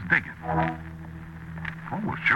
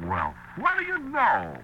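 delighted surprise; slower than usual. Acted. Delighted to learn she's Irish.

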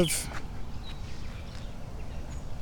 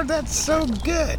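much higher, slow cooing praise; high, slow pet voice; slower than usual. Real, not acted. Farmer praises a happy hen in slow high cooing.

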